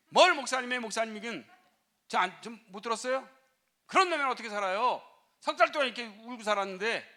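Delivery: mother defending her daughter, loud and upset; indignant protest. Retold or imitated. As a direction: cut the pastor off, fuming.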